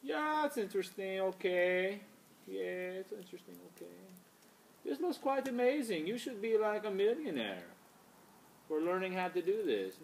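put-on high-pitched vague foreign accent; admiring co-host in an unplaceable accent; [name]. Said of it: "didn't know where his accent's from".

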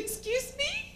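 very high, soft, shocked question; imitating a scandalised saleslady; taken-aback clerk. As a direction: squeak it out in polite disbelief. Taken-aback clerk answers the farmer.

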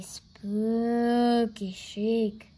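drawn-out, eerie stretch on "spooky"; spooky storytelling. A narrator's reading. Narrator builds suspense for listeners, eerie drawn-out delivery. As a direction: stretch "spooky" long like a ghost tale.

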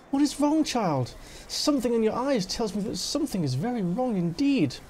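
a touch of solemn concern; concerned elder. Acted. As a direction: probe gently for what is wrong. Voices concerned Parda's dialogue.

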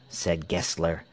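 ordinary delivery, nothing marked

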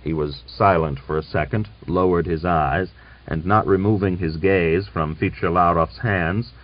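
ordinary delivery, nothing marked

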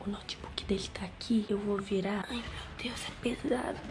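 strained whisper lifting something; hushed effortful whisper. Real, not acted. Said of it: "eu já tô falando baixo".

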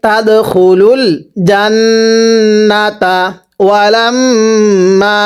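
slow recitation stretched only on set syllables; measured stretching. Shown as a demonstration. Teacher wants students to stretch where he does, measured recitation. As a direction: linger on আং, জান্নাতা and ওয়ালাম্মা. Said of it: "আমি যেখানে টান দিব".

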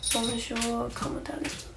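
"Bonjour, comment allez-vous" in a plain American accent, trailing to a whisper; a whispered, anglicised bonjour; flat American French. Shown as a demonstration. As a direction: read the French softly, no French accent.

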